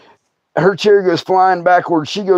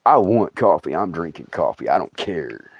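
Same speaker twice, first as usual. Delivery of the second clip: defiant retort; 11 semitones lower, 5 dB quieter than usual. Retold or imitated. His defiant answer to the snake.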